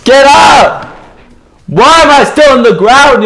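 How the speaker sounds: angry shout at the screen; frustrated shout. Real, not acted. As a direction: yell it out, fed up with being down. Frustrated gamer yelling at screen.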